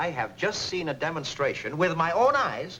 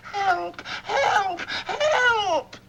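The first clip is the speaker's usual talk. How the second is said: mock screams for help; much slower than usual. Boss acts out the trapped girl for the school board, hammed-up screams. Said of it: "she screams".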